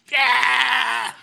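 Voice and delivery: high, drawn-out cry of exasperation; intense frustrated yell. Retold or imitated. Preacher acting out intense writing about a difficult relationship, mock frustrated yell. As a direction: let out a mock-frustrated "aaah". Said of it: "smaller and smaller and intense".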